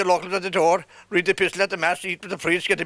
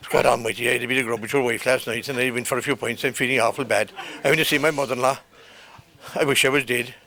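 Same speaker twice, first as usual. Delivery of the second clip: much lower, groaning sick man on the phone; sick man's voice; 7 semitones lower than usual. Retold or imitated. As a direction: drop the voice low and moan out his misery. Voicing a sick friend on the phone.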